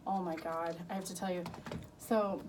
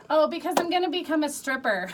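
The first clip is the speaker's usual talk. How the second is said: higher, louder, laughing; laughing quote; 7 semitones higher, 9 dB louder than usual. Retold or imitated. Mother retelling daughter's answer to boyfriend, laughing. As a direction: laugh through the daughter's line. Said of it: "She goes".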